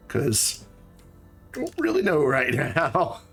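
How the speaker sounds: ordinary talk, nothing marked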